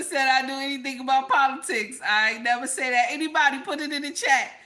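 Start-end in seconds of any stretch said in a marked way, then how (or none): none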